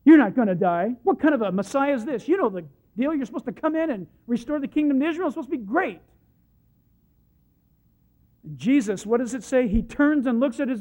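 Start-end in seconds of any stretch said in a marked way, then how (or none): 0.1-6.0 loud, insistent scolding of a wayward son; parental rebuke. Acted. Peter pulling Jesus aside, loud parental rebuke. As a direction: tell him off like a parent. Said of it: "It's a parental rebuke".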